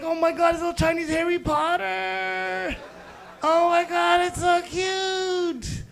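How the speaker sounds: high, drawn-out mock gushing; gushing squeal. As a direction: squeal over something adorable. Gushing over a cute kid.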